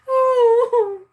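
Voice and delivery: laughing. Real, not acted. Cracking up at the name.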